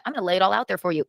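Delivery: usual speaking voice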